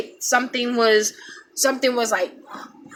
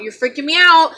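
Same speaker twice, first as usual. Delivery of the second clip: raised, louder retold protest; retold scream; 4 semitones higher, 6 dB louder than usual. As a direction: lift pitch and volume in mock panic. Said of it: "Like, I screamed at her".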